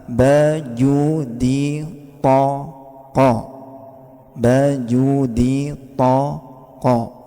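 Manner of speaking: "baju di toko" very slow, syllable by syllable; drawn-out memory aid "baju di toko"; mnemonic drill. Shown as a demonstration. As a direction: stretch and separate each syllable of "baju di toko". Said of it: "Kalau kepingin mudah".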